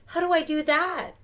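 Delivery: put-on puzzled kid asking; Fanatic's voice. First read of question.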